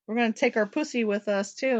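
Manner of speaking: ordinary talk, nothing marked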